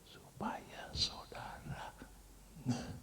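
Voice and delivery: slow voiceless whisper. Shown as a demonstration. Preacher teasing the church with a whisper. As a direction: whisper instead of the promised shout. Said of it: "Saya mau teriak nih".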